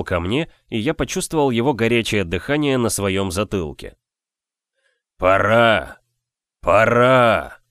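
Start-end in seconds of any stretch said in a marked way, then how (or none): 5.2-7.6 loud booming voice from beyond; thundering otherworldly voice. A narrator's reading. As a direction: thunder it like a ghostly giant. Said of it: "прогремел он потусторонним голосом".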